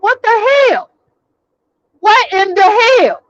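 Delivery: can't believe what just happened; incredulous. Real, not acted. Disbelief at the whole call.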